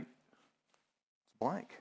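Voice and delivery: hushed and let down; deflated, soft. Retold or imitated. Panda finding the scroll blank, stunned and soft. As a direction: let the air out, it's blank. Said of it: "And he's like".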